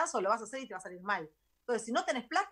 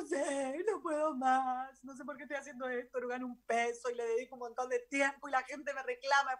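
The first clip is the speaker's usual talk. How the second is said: teary, slowed plea with a slightly higher voice; retold crying; 3 semitones higher than usual. Telling her friend Cari she can't go on, teary. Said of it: "llorando le digo".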